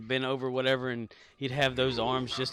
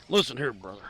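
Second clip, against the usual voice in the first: Hulk Hogan impression; 6 dB louder than usual. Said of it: "I need to put some Hulk Hogan on here bad".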